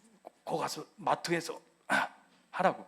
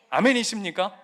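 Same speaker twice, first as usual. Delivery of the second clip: raised, emphatic call to the congregation; calling for amen; 6 semitones higher, 8 dB louder than usual. Real, not acted. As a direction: call out for their response.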